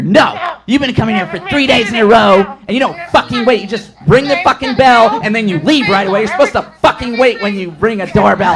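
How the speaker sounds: loud, high-pitched mock tirade; imitated cussing-out. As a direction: imitate an irate customer ranting at the courier. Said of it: "she cussed you out".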